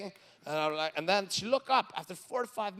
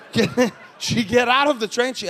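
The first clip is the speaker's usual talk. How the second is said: ordinary talk, nothing marked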